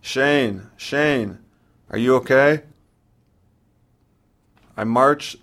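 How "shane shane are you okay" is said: a touch of panic, calling higher; anxious calls down the line, lightly voiced; mild panic. A narrator's reading. Mom calling her silent son down the phone, mildly panicked. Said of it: "She panics".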